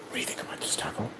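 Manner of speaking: soft, unvoiced aside; whispered to the pet. Real, not acted. Vlogger whispering a question to his pet Taco. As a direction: whisper it to the pet beside you.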